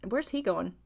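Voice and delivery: ordinary talk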